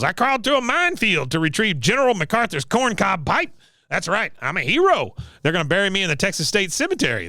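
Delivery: high boastful war-veteran impression; self-important old veteran voice; Cotton. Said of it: "He goes".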